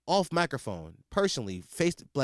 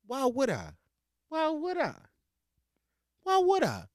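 mock innocent; 11 semitones higher than usual. Real, not acted. Mocking rhetorical repeat.

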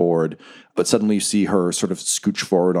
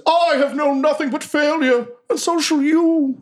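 haunted villain. Acted. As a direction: promise failure like a desperate dark knight. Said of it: "He says".

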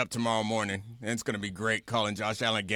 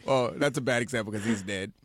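ordinary talk, nothing marked

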